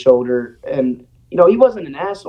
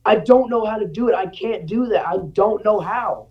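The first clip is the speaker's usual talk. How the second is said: put-on higher, pleading voice of a frightened kid; scared pleading; 6 semitones higher than usual. Kid pleading with the coach, scared. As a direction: plead that you can't do it.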